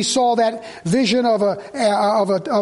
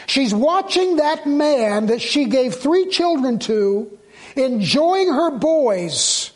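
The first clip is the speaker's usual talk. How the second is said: high, slow, drawn-out preaching; impassioned, slow pulpit delivery; forceful preaching; 5 semitones higher, much slower than usual. Real, not acted. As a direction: stretch the words out with rising force.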